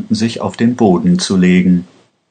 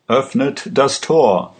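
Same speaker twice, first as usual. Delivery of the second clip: shouted order; 5 semitones higher than usual. A narrator's reading. Aladdin ordering his men to open the gate, higher shouted command. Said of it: "schrie Aladdin".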